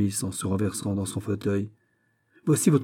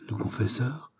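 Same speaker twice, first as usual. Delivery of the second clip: softer, halting question; stunned murmur; 3 semitones higher, 7 dB quieter than usual. A narrator's reading. Governor echoes the visitor's revelation, stunned murmur. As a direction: murmur it back, barely believing. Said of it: "murmura-t-il".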